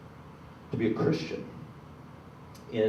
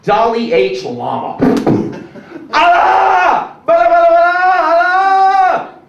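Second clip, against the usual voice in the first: high, loud mock curse shouts; hammed-up outburst of holy names; mock cursing; 7 dB louder, slower than usual. Comic cursing at the crowd, loud mock shouting. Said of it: "you're not gonna see me".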